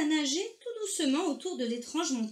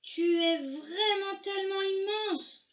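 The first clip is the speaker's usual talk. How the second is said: high, slow calling voice; amazed call from afar; 8 semitones higher, slower than usual. A narrator's reading. Argento marvels at the whale from afar, high amazed call. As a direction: call it across the water, stretched out high. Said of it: "cria Argento de loin".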